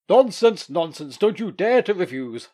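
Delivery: loud, high, vehement protest; insistent. Acted. The Doctor insisting the lawyer keep the gift, loud. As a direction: refuse to take no for an answer.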